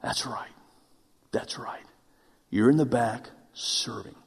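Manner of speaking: soft, near-whispered answer from the Lord; quiet, gentle divine reply; hushed voice of God. Retold or imitated. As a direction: drop to a hush, as if God speaks close. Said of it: "I heard the Lord say".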